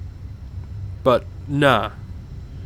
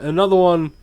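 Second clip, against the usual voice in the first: normal talking voice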